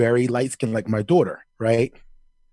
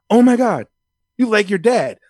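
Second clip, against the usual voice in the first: high, loud imitated gasp of surprise; mock shock; 8 semitones higher, 6 dB louder than usual. Classmates seeing the dad's photo, loud high mock astonishment. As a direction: play the stunned classmates.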